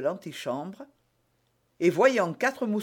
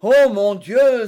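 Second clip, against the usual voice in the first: slow, very loud cry, pitched up; exclamation; 6 semitones higher, 13 dB louder, slower than usual. A narrator's reading. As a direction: draw out a big loud exclamation. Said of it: "s'écria Porthos". (grande exclamation sonore et traînante)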